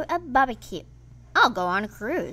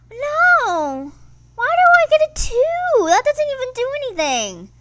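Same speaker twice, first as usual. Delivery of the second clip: much higher, louder wail of protest; frustrated outcry; 13 semitones higher, 7 dB louder than usual. Real, not acted. Player wails at rolling a two in the game. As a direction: cry out at the unfair roll. Bad dice roll in game.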